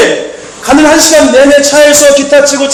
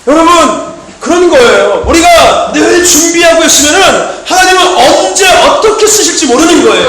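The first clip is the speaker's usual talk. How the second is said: fiery preaching; 3 semitones higher than usual. Acted. Pastor urging the congregation to stay ready, fiery preaching. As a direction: preach it hard and wait for the amens.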